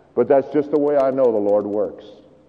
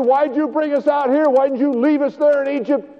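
panicked complaining; 11 semitones higher than usual. Retold or imitated. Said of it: "they're screaming and crying and saying".

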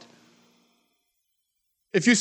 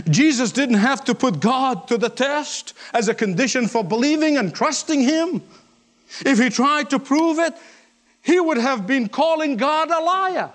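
raised, ringing sermon delivery; impassioned preaching; 5 semitones higher than usual. Real, not acted. Preacher warning listeners against testing God, impassioned. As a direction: drive the point home from a high pitch.

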